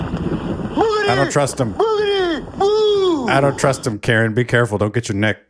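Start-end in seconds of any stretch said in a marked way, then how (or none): 0.0-1.7 very high, slow quavering warning; melodramatic high voice. Acted. 1.8-4.0 very high, slow fake sob; mock crying. Repeated dramatic warning, crying.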